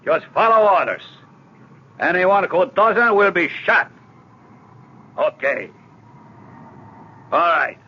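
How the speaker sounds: loud barked commands to a cabin full of passengers; shouted orders. Acted. Hijacker Garcia commanding the passengers at gunpoint, loud shouting. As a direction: bark the orders so the whole plane hears.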